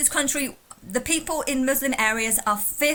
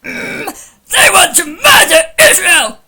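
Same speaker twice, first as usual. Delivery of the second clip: parody scream; 8 semitones higher, 15 dB louder, slower than usual. Retold or imitated. Parody shout while laughing.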